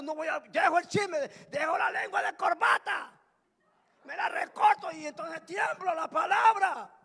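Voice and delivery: high, loud, emphatic sermon delivery; fired-up preaching. Real, not acted. Preacher acting out a gossip trembling at God's word, rising preaching shout. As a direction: build to a shout as the gossip repents.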